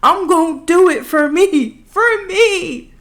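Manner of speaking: high, loud, hammed-up declaration; mock dramatic. Student vowing to pass for herself, over-the-top dramatic. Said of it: "I'm being a little dramatic".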